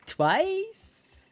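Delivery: high, rising disbelief; incredulous. Real, not acted. Patient on being misdiagnosed in America, incredulous. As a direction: repeat it as a squeaky, astonished question.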